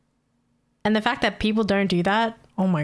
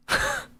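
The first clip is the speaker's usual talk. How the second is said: squeaky laugh; 4 dB quieter than usual. Real, not acted. Laugh after the rant.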